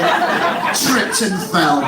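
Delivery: very slow, drawn-out words; savoured repeat. Real, not acted. Comic relishing the patient's classic excuse, slow savoured repeat. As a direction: stretch each word to relish it.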